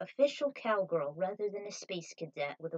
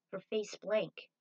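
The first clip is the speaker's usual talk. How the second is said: ordinary delivery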